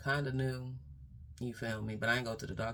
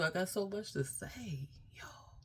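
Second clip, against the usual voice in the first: hushed whisper to the audience; whispered aside; 8 semitones higher, 3 dB quieter than usual. Real, not acted. Letting viewers in on a family secret in a whisper.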